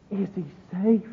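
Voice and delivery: high-pitched frightened question. Acted. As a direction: ask it as a scared Lucy. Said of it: "and she's frightened".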